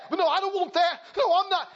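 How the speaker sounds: high, acted protest; desperate denial. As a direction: keep denying under attack. Accused man protesting.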